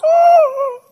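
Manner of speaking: high, loud anguished cry; man crying out as time runs out; despairing scream. Retold or imitated. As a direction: wail like you just lost a million. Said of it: "lets out this scream".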